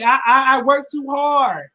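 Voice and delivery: ordinary talk, nothing marked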